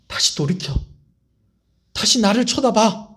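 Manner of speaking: Jesus urging Peter. Acted. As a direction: lift him back to his feet, building.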